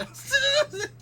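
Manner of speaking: very high, squealing laugh. Real, not acted. Quiz host cracking up at chat's earworms, laughing. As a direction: burst into loud squeaky giggles.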